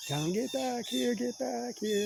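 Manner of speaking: high, slow imitation of a man calling his dog; mock yelling dog owner. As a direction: mimic the guy hollering for his dog, pitched high. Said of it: "he's out there screaming and yelling".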